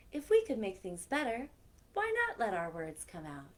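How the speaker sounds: lively storybook read-aloud; reading to children. A narrator's reading. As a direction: tell it like story time for kids. Reads picture book aloud.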